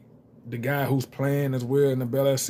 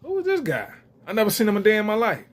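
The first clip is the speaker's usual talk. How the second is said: shocked disbelief; 8 semitones higher, faster than usual. Retold or imitated. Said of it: "I was like".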